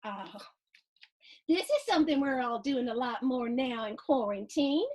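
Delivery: ordinary delivery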